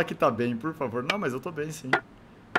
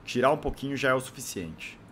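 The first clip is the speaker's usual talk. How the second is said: ordinary talk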